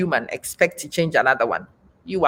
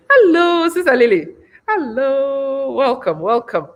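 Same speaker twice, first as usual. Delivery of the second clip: excited greeting; 9 semitones higher, 4 dB louder than usual. Real, not acted.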